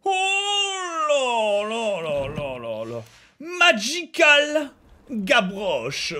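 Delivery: oh là là très étiré et lent; s'extasier en traînant les mots; admiratif. Acted. Admire une tenue. (admiration exagérée)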